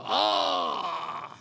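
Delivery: short low cry of a man struck on the head; pained cry. Retold or imitated. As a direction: yelp as the pot hits. Pot strikes the Buddhist leader.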